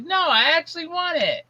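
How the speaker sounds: high-pitched pleading kid imitation; whining child. Daughter insisting she wants it, high whine. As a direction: mimic a kid whining for something. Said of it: "You're just whining".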